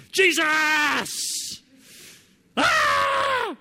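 full-voiced high shout of a desperate prayer; Loke's loud prayer. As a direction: cry out to Jesus at the top of your lungs. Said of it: "when he prays, he's really loud".

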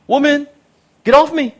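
comic loud rebuff; exasperated Jesus. Retold or imitated. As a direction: tell her to back off. Acting Jesus pushing Mary away.

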